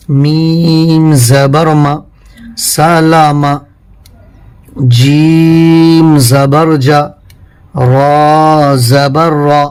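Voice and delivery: slow, chanted spelling for pupils; slow teacher's drill chant; Qaida recitation drill. Shown as a demonstration. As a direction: recite each syllable deliberately like a teacher. Leads the class through a recitation drill.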